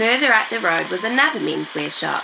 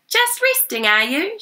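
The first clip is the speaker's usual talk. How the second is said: much higher, bright shop assistant voice; cheery; 12 semitones higher than usual. A narrator's reading. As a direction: greet him cheerfully, high and lively. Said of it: "she asked cheerily".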